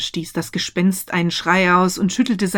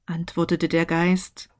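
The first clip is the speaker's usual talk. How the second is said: ordinary delivery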